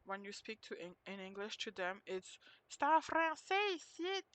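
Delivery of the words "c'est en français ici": high, emphatic caricature; mock Québécois motto. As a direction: exaggerate it like an insistent local slogan. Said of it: "the motto of Québécois".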